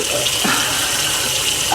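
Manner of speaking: a long, unvoiced sigh; weary sigh. Shown as a demonstration. A sigh to show the viewers how she always sounds. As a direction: let a tired sigh out before speaking. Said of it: "unsure and sighing".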